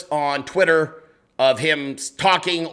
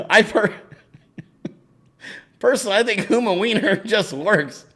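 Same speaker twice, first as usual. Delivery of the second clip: laughing; 6 semitones higher than usual. Real, not acted. Laughing at a viewer's joke.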